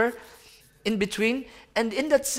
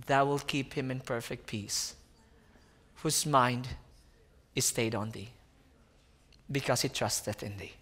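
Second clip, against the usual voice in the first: reverent scripture recitation; 9 semitones lower, 9 dB quieter than usual. Acted. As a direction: read the verse like a hushed prayer.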